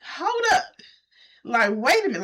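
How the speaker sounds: high, sharp outraged disbelief; jolted by a bad surprise; seeing the property, shocked and rising. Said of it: "I was in shock".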